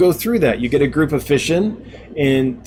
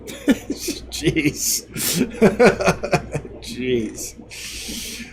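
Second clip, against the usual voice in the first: laughing; 2 semitones higher, 6 dB quieter than usual. Real, not acted. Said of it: "knock it off, man".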